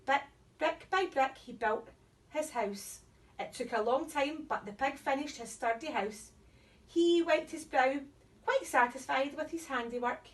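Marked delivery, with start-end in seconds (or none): none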